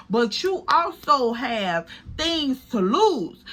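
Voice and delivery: ordinary talk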